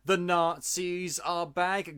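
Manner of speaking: ordinary talk, nothing marked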